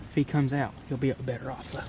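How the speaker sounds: ordinary talk, nothing marked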